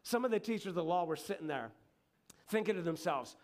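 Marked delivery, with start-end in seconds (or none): none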